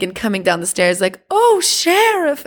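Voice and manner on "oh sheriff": high, drawn-out mock saloon madam; madam of the bar. Madam coming down the stairs to the sheriff, hammed-up high coo. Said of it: "like, the madam of the, of the bar".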